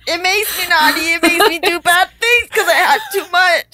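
custard-sick whiner. Acted. Woman who overdid the custard, confessing in a high whine.

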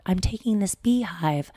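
put-on soft ASMR bee girl. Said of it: "she talks about".

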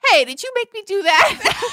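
put-on an innocent small child asking, giggling; laughing kid voice. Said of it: "looked at them and said".

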